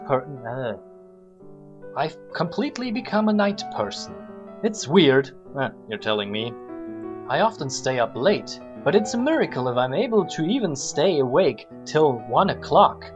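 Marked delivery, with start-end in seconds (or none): none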